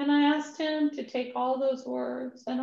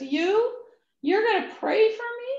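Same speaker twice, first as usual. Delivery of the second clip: touched disbelief; 8 semitones higher than usual. Retold or imitated. Moved man asks in disbelief.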